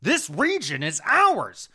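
hammed-up indignation, building high and loud; mock pissed-off Pope. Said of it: "The Pope gets super pissed off".

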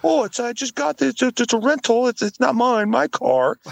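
high, pleading imitation; mock sob story. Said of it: "started going into some sob story".

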